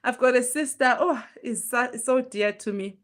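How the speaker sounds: ordinary delivery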